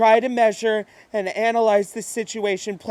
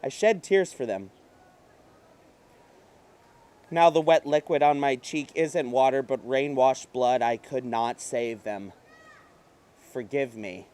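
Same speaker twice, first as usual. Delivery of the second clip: lower, softer mourning; fading, guilty murmur; grief-stricken murmur; 8 semitones lower, 7 dB quieter than usual. Acted. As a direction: sink into sorrow. Grief, sinking voice.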